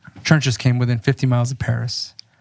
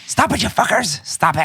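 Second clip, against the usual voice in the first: high-pitched officer shouting; 8 semitones higher than usual. Acted. Officer yelling at troops.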